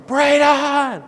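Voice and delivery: high, drawn-out desperate holler; yelling. Retold or imitated. Father squeezed into the hole calling his son, yelling. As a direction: yell the name long and loud. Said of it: "and I'm yelling".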